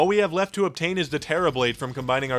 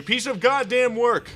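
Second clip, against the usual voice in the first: higher, slow exasperated outburst; 8 semitones higher, slower than usual. Real, not acted. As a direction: let it burst out in disgust.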